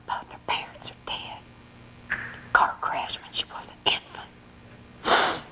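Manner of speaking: hushed whisper. Acted. Saleslady whispers to Verna about the orphan. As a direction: whisper it so the girl won't hear. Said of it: "The blonde-haired one whispers to Verna".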